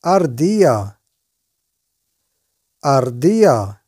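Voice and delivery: أردية said as ardilla, slow and clear; Spanish word drilled. Shown as a demonstration. Teacher to viewers, repeating the Spanish word clearly. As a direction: give أردية carefully, falling at the end. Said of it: "وهو السنجاب".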